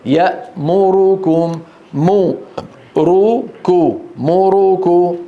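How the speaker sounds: يأمركم split slowly, mu ru ku; slow syllable drill. Shown as a demonstration. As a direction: spell out each short vowel of يأمركم. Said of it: "وذلك نحو".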